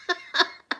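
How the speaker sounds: short high-pitched laugh; real laugh. Real, not acted. Laughing at taking till night to realise, high giggle. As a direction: laugh at your own slowness. Laughs at her own slowness.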